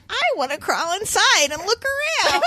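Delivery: high creaky granny voice; old lady voice. Retold or imitated. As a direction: sing it like a sly old lady. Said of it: "an old lady voice".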